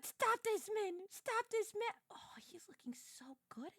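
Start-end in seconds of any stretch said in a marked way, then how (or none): 2.1-3.7 whispered, no voice at all; a secret, breathless whisper; awed whisper. Real, not acted. Streamer ogling the hero on screen, whispered.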